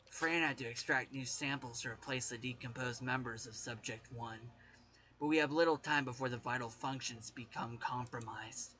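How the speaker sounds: low, subdued diary reading; research notes read aloud. Acted. Reading a researcher's worried notes about Subject one, subdued narration. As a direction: read the experiment log softly and evenly.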